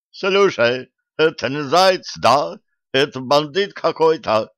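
high, loud groaning complaint; groaning wolf. A narrator's reading. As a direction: moan it like a battered wolf. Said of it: "стонал Серж".

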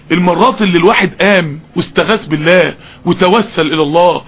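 ordinary talk

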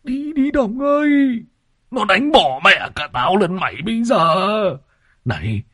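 high, strained panicky plea; frantic high-pitched warning to a friend; panicked urging. A narrator's reading. Panicked companion urging Đồng to flee.

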